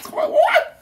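high, loud shriek; shocked shriek. Real, not acted. Reacting to her own teeth on camera, shocked. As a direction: yelp it in disbelief.

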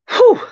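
loud, high puff of relief; sharp high exhale after bending down; winded whew. Real, not acted. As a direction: blow out a whew, out of breath. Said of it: "I'm out of breath now".

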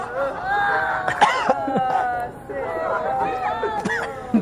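high screaming laughter; shrieking laugh. Real, not acted. Cheering at the chug.

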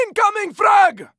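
high urgent shout; warning the squad of a thrown grenade, urgent shout. Acted.

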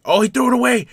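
loud, high cry at a sudden error; excited outburst. Real, not acted. Gamer spotting the opponent's bad throw, excited outburst. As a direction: shout it out as the play goes wrong.

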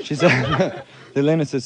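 ordinary delivery

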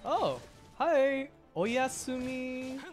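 usual speaking voice

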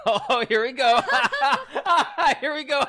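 giggling through the words; laughing. Real, not acted. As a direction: laugh it out, delighted. Laughing as the trap lands.

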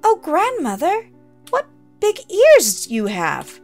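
put-on wide-eyed little girl marvelling; a touch of unease in a childlike voice; girlish wonder.

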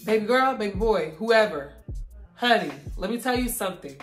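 ordinary talk

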